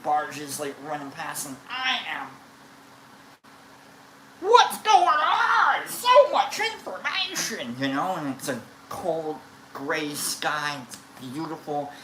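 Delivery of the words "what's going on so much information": high, loud, drawn-out mock wail; overwhelmed outburst. Storyteller acts out being overloaded by it all, high overwhelmed cry. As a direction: stretch it out in a high, overwhelmed yell. Acting out his overwhelmed state.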